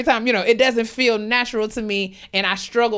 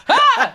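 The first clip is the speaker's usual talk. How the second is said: much higher, louder imitated yelp; mock sudden yelp; 7 dB louder than usual. Host imitating her sinus-triggered outburst, mock yelp. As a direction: blurt a startled cry like ears popping. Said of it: "I just be like".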